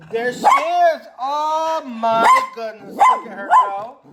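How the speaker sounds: thrilled, cheering your barking dog; excited about dog. Real, not acted. Vlogger watching his dog bark at passerby, excitedly high.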